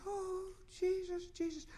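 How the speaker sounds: high, slow and soft, melting; tender cry to Jesus. Retold or imitated. The healed lady melting before God, high soft and slow. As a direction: breathe the name out softly, way up high. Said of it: "and just said".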